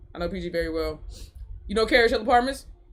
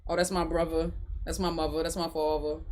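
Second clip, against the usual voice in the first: put-on low, soft Maryland accent, v for th; imitated Maryland accent; 5 semitones lower, 7 dB quieter than usual. Streamer fondly imitating locals, Maryland accent. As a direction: say "father" as "fahva" in Maryland accent. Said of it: "I love the way y'all talk".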